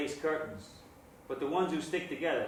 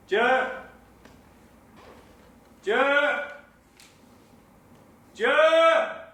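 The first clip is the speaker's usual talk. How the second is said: high, escalating yell for someone upstairs; calling out loudly; 11 semitones higher, 10 dB louder, much slower than usual. Acted. Summoning Joe to come down, shouted louder each call. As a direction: holler the name, louder and higher each time.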